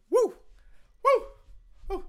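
short high falsetto whoops; swooning woo. Real, not acted. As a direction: whoop as if the accent is too much. Swooning over Southern accents.

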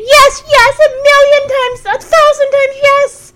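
high, breathless mock gushing; gushing girl. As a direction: swoon over him, way too eager. [name] gushing yes.

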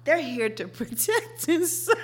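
breaking into laughter mid-line; can't keep a straight face; laughing. Real, not acted. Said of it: "I can't even say it with a straight face".

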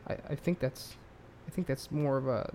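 ordinary talk, nothing marked

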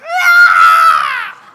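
high shrieking yell at the snake; hammed-up terrified shriek; panicked scream. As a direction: scream like a frightened kid. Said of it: "But I was like".

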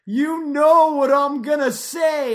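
Louis drawing it out; slow Louis tease. Retold or imitated. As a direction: stretch every word teasingly. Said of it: "He'll be like".

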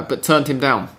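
normal talking voice

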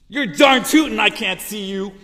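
loud, high desperate cry; pleading and upset. Retold or imitated. Wife crying out to God for help, loud upset plea. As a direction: beg for help, worn out. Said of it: "fuming and yelling inside at God".

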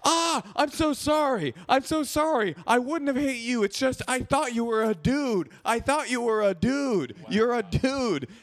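high, slow, whiny sorry; mock apology. J J apologising to the girl in a high whine. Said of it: "J J starts going".

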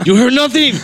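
high loud mock yell. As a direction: shout it back playfully. Loud retort.

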